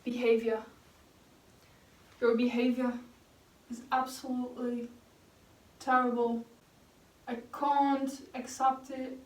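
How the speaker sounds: deliberate, put-on British pronunciation; amateur British accent, word by word. Shown as a demonstration. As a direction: show off a few words in a British accent. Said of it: "I have a few words that I can pronounce in British".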